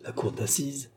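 very soft, stunned question; hushed, bewildered echo; distraught murmur. A narrator's reading. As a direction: repeat it under your breath in disbelief. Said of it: "murmura-t-elle, éperdue".

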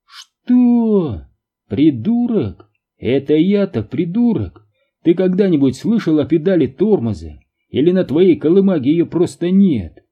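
high, loud, outraged tirade; a father raging at the driver; furious rant. A narrator's reading. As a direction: let the anger run away with you. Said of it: "Его уже понесло".

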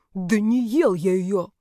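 slow, indignant denial; hissed, affronted protest; indignant whisper. A narrator's reading. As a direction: protest in an offended stage whisper. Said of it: "возмущённый шёпот".

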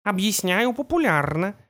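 cartoon kitten voice. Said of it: "мультяшно промяукал он".

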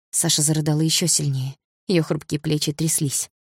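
ordinary delivery, nothing marked

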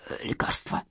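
very soft, breathy groan; sick man barely getting the word out; feeble moan. A narrator's reading. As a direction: moan it weakly, almost voiceless. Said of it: "простонал тот".

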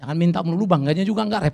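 ordinary talk

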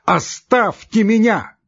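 high, drawn-out enraged cry; frenzied shout. A narrator's reading. Doctor driving his visitors away, frenzied shout. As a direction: shout it in a voice not your own. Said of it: "крикнул он не своим голосом".

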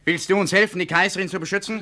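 loud, high, pressing demand. Acted. Son demands his father's help, loud and pressing. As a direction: push him hard for an answer. Men dragging old man away.

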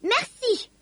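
high little elf voice; squeaky, relieved elf. A narrator's reading. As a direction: blurt thanks in a high elf voice. The elf is relieved and thanks her.

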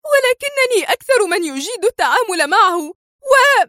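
high, insistent cry of protest; indignant protest. Acted. Sonia protesting to the director, indignant and high. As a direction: defend yourself against the charge. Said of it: "فهتفت في استنكار".

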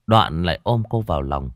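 ordinary delivery

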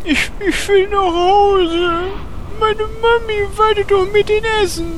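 hoch, schluchzend und gehetzt; weinerlich. Acted. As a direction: jammere wie ein verängstigtes Kind. Horst weint vor Angst. (Horst jammert weinend nach Mami)

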